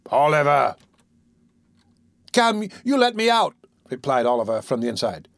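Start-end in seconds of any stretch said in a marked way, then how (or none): 0.1-0.7 deep impressive tone. Acted. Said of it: "in a deep and impressive tone". 2.3-3.5 put-on high, defiant boy shouting through door. Oliver voiced.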